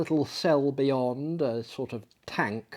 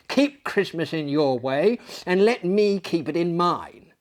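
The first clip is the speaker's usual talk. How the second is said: sharp, higher stern address; 6 semitones higher, 6 dB louder than usual. A narrator's reading. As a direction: cut him off with sternness. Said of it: "returned the uncle sternly".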